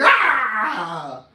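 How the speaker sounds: short loud mock scream of frustration; exploding yell. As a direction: let out one sudden yelled burst. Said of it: "and you're like".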